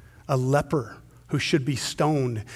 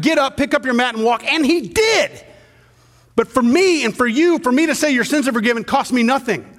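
higher and louder, driving the point home; full-force preaching; 8 semitones higher, 5 dB louder than usual. Acted. Pastor pressing the congregation on forgiveness, full-force preaching. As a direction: preach it out with rising fire.

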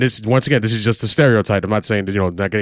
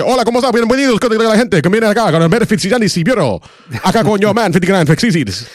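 high, loud Spanish sports announcer; football announcer; 10 semitones higher, 4 dB louder than usual. Pretend. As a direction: call it like a Spanish football broadcaster. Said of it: "Sound like the, uh, the football announcer".

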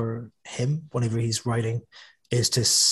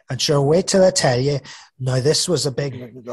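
broad Northern Irish; 3 semitones higher, 7 dB louder than usual. Shown as a demonstration. Author demos the broad Northern Irish tone his book avoids. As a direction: ham up a cheery broad Northern Irish yarn. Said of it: "that kind of slightly broad Northern Irish".

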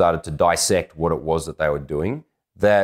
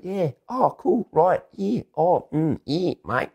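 high murmurs of parodied Australian agreement; mock Australian small talk; 13 semitones higher, slower than usual. Australians seducing each other with mere agreement, high parody. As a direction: send up Australian flirting in high, drawn-out replies. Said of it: "This is Australian seductions happening".

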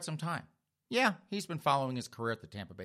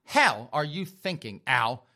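loud, slow, punched-out scolding; enraged; 3 semitones higher, 5 dB louder, slower than usual. Acted. Girlfriend furious at her boyfriend's answer, enraged. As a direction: hammer each word at him. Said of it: "she got just enraged at him".

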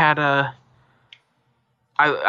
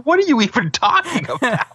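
incredulous friend; 12 semitones higher than usual. Retold or imitated. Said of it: "He's like".

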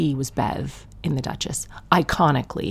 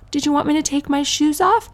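high, timid polite-guest voice; mock polite workman; 11 semitones higher than usual. Comic mimics a workman at her door, high and timid. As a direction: ask meekly like a shy workman. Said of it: "they go".

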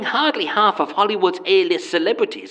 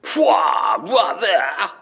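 posh toff; 3 semitones lower, much slower than usual. Acted. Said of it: "posh toff exclamation".

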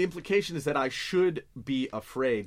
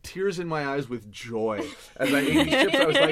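laughing; 4 semitones higher, 10 dB louder than usual. Retold or imitated. Joyful chip story, laughing.

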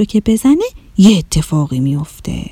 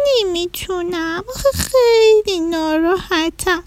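high, whimpering, slowed crying voice; tearful rabbit; 8 semitones higher, 5 dB quieter than usual. Acted. Rabbit whining to the mouse, high and tearful. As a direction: sniffle the words out like a sad bunny. Rabbit upset, asking for help.

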